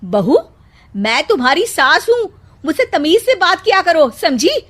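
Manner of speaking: loud, scolding outburst; angry mother-in-law. Acted. Mother-in-law scolds rude bride, loud angry outburst. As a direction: tear into the bride. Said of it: "वो मेघा पर बरस पड़ती है।".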